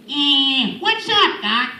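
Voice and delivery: high, cheeky cartoon rabbit; Bugs Bunny. Speaker shows voices Mel Blanc taught him, cheeky rabbit voice. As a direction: toss it off as a wisecracking cartoon rabbit. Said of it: "the voice of Bugs Bunny".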